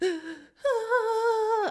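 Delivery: high wailing cry, acted out; hammed-up gasp and long high wail; mock hysterical sob. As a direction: imitate bursting into sobs. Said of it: "sobbing hysterically".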